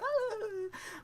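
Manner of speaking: squealing high laugh. Real, not acted. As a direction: burst into a high giggle. Laughing at himself.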